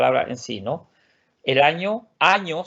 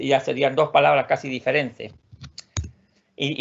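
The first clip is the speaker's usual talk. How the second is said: ordinary talk, nothing marked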